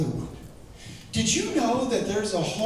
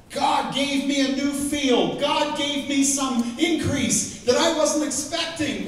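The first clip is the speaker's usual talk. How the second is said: higher, slower, building joy; rising excitement; 7 semitones higher, slower than usual. Acted. Preacher listing blessings with growing joy. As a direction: lift each line higher in praise.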